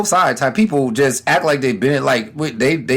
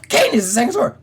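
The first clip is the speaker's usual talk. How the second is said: ordinary delivery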